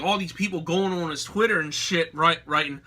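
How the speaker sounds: usual speaking voice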